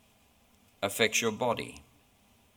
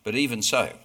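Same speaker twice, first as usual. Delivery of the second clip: ordinary talk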